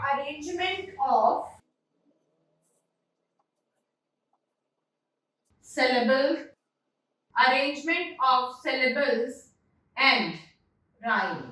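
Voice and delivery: very slow, broken by long pauses; slow dictation. Real, not acted. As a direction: spell out the phrase piece by piece for note-taking. Dictating phrase while writing it. (बहुत धीरे, रुक-रुक कर लिखवाते हुए)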